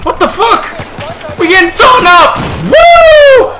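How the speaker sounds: high frantic yell; excited yelling. Real, not acted. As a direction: yell it as the fight erupts. Getting overrun in game.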